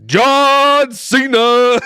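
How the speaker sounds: slow, high, booming announcer call; John Cena intro. Pretend. Friend hyping a wrestler's entrance with a big announcer call. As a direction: belt the name like a ring announcer.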